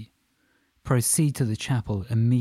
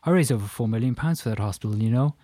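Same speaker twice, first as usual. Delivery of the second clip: fast, chatty Irish man, louder; Irish accent; 2 semitones higher, 5 dB louder than usual. Acted. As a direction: rattle on in an Irish brogue. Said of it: "in a strong Irish accent".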